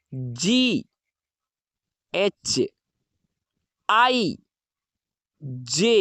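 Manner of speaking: each letter sung out alone with a pause; slow alphabet recital. Shown as a demonstration. Teacher reciting letters slowly to the class.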